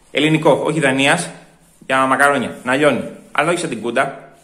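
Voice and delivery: normal talking voice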